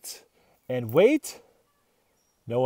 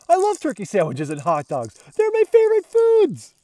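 much higher, louder squeal; excited dog; 9 semitones higher, 7 dB louder than usual. Acted. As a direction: gush over the foods like a greedy dog. Dog raving about smells.

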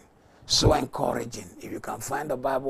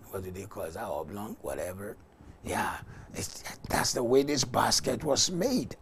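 ordinary delivery

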